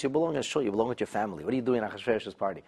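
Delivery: normal talking voice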